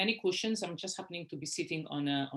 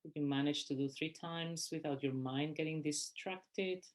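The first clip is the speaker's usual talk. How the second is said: guided meditation; 3 dB quieter than usual. Real, not acted. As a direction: guide them gently through each breath, unhurried. Leads a breathing meditation.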